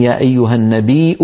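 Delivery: النبي said an-nabii'u, with a hamza after the long ee; hamza reading. Shown as a demonstration. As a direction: sound a glottal stop at the end of النبي. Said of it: "يقرأها مهموزة".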